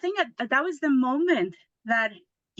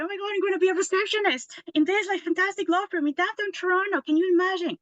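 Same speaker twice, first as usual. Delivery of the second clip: thrilled squeal, much higher and fast; super happy; 10 semitones higher, faster than usual. Retold or imitated. Telling of landing the law firm interview, excited high gush. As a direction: gush like you just got the dream job. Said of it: "I was super happy".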